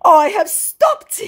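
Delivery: very high, loud, slow wail; hammed-up protest; insisting scammer. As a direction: squeal it out, drawn out and theatrical. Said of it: "he repeats".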